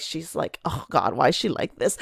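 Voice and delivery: eye-rolling mockery. Manager mocking her to a guy, quiet eye-rolling mutter. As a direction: mutter it like you're rolling your eyes. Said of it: "roll his eyes and be like".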